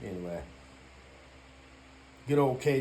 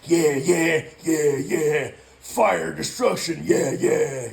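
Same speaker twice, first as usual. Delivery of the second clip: loud, gleeful yeah-yeahs cheering on fire and destruction; mock eager cheering; 7 dB louder, much slower than usual. Mocking believers whose J C wants destruction, in a loud gleeful cheer. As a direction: ham it up like a zealot egging on destruction. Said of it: "Their J C is like".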